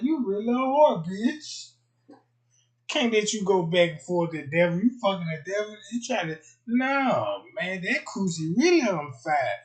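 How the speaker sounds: high, loud mocking tirade; mock rant. [name] loudly ranting at the girl who cheated. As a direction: tear into her. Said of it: "I'm like".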